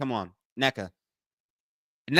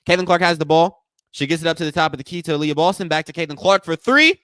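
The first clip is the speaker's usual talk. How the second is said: loud, raised game call; live play-by-play; 4 semitones higher, 7 dB louder than usual. Real, not acted. Host calling a three-point attempt, loud play-by-play. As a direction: build up to the shot.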